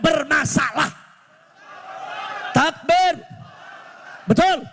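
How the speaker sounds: high-pitched rally oratory, building to a shouted takbir; impassioned preaching. Real, not acted. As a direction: drive each demand home to the crowd. Preaches at full force.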